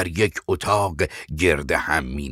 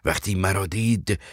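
ordinary delivery, nothing marked

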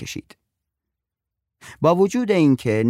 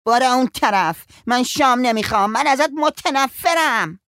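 high, loud, defiant yell; sulking boy screaming at his mother, high and loud; angry boy yelling; 14 semitones higher, 4 dB louder than usual. Acted. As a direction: shout her away from up the tree.

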